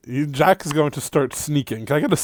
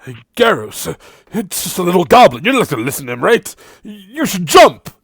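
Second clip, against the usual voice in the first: higher, louder coaxing voice; character urging; 7 semitones higher, 7 dB louder, faster than usual. Acted. Farron urges Garros to jump, coaxing and higher. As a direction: egg him on with a sly nudge. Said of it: "and goes".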